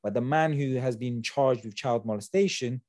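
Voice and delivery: usual speaking voice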